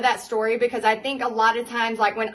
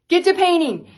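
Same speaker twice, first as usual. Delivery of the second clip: higher, louder shouted order; louder repeat; 7 semitones higher, 6 dB louder than usual. Retold or imitated. Bobby with a pile of orders, shouting at his wife to paint. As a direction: drive it home, now shouting. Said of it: "And he goes".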